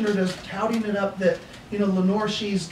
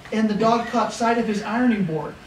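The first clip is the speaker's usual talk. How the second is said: normal talking voice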